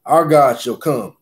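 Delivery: usual speaking voice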